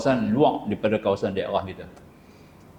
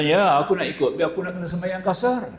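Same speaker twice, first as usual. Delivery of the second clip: put-on pitched-up, eager old man insisting on coming along; lightly voiced old man, pleading to join; Tok Long insisting; 7 semitones higher than usual. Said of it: "Tok Long kata". (suara orang tua yang mendesak, nada tinggi)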